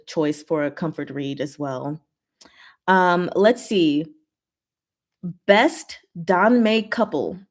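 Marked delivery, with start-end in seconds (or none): none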